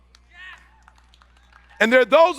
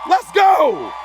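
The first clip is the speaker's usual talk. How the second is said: rallying shout; 9 semitones higher, 3 dB louder than usual. Retold or imitated. Repeated rallying cry.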